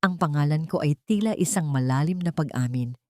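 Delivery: plain reading voice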